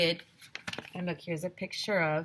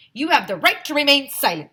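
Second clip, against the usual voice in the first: higher, louder stern cop; policeman voice; 8 semitones higher, 9 dB louder than usual. A narrator's reading. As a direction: read him his rights loud and high. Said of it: "said the second policeman".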